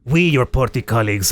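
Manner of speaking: lightly hammed-up Russian accent; playful Russian accent on a party letter; mock Russian accent. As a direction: read it like a Soviet official with a Russian accent. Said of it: "none of my terrible Russian accent".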